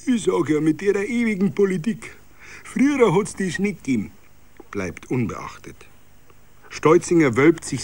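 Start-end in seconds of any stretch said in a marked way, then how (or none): none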